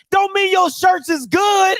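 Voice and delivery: usual speaking voice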